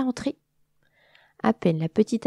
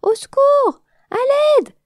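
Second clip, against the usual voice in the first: screaming for help; 10 dB louder, slower than usual. Acted. Bee drowning, calling for help.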